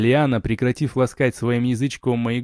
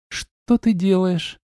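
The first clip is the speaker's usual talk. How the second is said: slow, high female voice; smiling wife; 7 semitones higher, slower than usual. A narrator's reading. Wife with eyes closed to husband, smiling question. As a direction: ask it lazily with a smile. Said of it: "улыбнулась, не открывая глаз".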